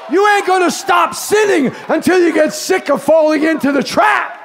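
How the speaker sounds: high shouted litany; a preacher rousing the congregation against sin; full-force preaching. Acted. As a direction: hammer each line at the crowd.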